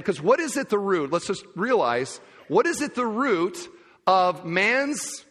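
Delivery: usual speaking voice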